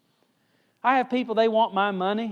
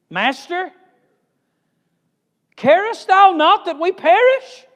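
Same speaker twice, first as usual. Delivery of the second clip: loud, much higher cry of the frightened disciples; stern panicked shout; 12 semitones higher, 10 dB louder than usual. Acted. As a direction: yell it at Jesus over the storm. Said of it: "in quite a stern way".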